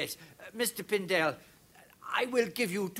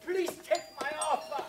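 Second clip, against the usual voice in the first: desperate pleading; 8 semitones higher than usual. Acted. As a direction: beg him not to refuse. Stranger begs for the trunk.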